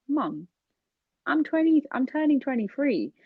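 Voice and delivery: high-pitched, acted pleading indignation; exasperated protest. Child arguing with mum about age, acted exasperated protest. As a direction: protest to a parent with pitch shooting up. Retold protest at being too young.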